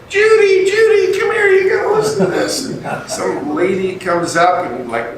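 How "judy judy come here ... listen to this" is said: much higher, louder call across the house; yelling for wife. Retold or imitated. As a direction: shout for Judy over the laughter. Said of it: "he started yelling".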